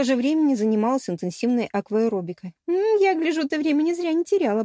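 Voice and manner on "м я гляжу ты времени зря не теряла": high, knowing tease; teasing. A narrator's reading. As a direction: tease a friend with a verbal wink. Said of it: "подмигнула я". (игриво поддразнивая)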